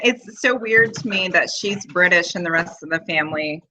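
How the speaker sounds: ordinary delivery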